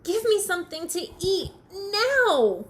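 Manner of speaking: high, loud, stretched final word; hungry, bossy yell; shouting demand. Acted. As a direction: demand it loudly, hammering the end. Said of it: "shouted Bird".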